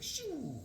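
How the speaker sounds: soft, high drawn-out shoo; whoosh. Retold or imitated. Retelling the hero flying off, imitated whoosh.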